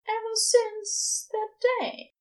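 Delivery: admitting. A narrator's reading.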